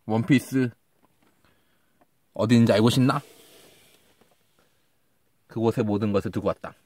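low dramatic pirate quote; hushed, ominous pirate king line; dramatic villain voice. Pretend. Quotes One Piece opening line.

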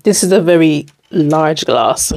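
usual speaking voice

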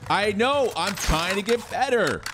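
panicking gamer. Real, not acted. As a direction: blurt it out, exasperated. Frustrated gamer after losing.

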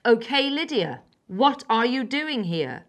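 loud, slow and hard; insistent demand. A narrator's reading. Wild girl interrogating Lydia, loud and insistent. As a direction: press the question firmly.

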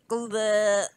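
dull, blocked horn blurt; clogged trumpet noise. A narrator's reading. Trumpeter blowing a clogged horn, muffled blurt. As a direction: imitate a horn stuffed with goo. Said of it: "all the royal trumpeter blew was".

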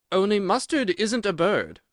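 a lightly voiced little girl; young girl voice. A narrator's reading. Alice remarks.

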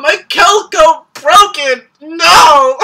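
high, loud tearful lament; annoyed mock wailing. Fan asking viewers about the breakup, mock wail. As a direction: cry out in hammed-up anguish. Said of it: "I'm so annoyed by that".

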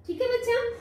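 ordinary talk

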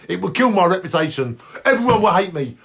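ordinary talk, nothing marked

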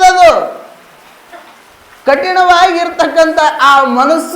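high, sustained sermon voice; forceful preaching. Acted. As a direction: drive the teaching home to the congregation. Preaches in declamatory voice.

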